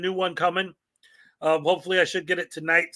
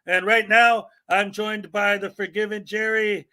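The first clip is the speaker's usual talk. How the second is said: ordinary delivery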